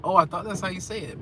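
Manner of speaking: usual speaking voice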